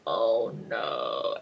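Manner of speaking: low, soft, sinking oh no; dismayed oh no. Retold or imitated. Realizing Walter must die, a low dismayed murmur. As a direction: let it drop in dismay. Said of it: "And you're just like".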